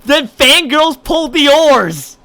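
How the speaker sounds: high, loud, slow proclamation; dramatic declaration. Acted. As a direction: proclaim it grandly like a slogan.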